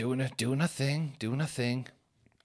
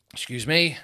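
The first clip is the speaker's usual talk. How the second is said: normal talking voice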